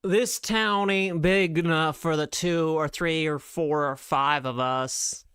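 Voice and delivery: slow, put-on American western accent; hammed-up American gunslinger showdown line; mock American cowboy drawl, slow and deliberate. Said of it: "Do I do a good American accent?".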